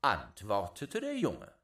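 ordinary delivery, nothing marked

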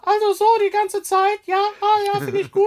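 high put-on voice, mock enthusiastic; high mock-praise impression; imitating Jan-Philipp Zimny. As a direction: ham up a colleague's gushing praise. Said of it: "Jan-Philipp Zimny, der auch in höchsten Tönen von dir sprach".

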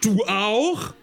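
high, incredulous exclamation; shocked cry. A narrator's reading. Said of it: "schrie Tina". (hoher, ungläubiger Ausruf)

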